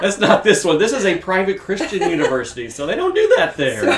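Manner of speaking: high, loud, laughing protest. Real, not acted. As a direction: protest through giggles. Flustered laughing correction.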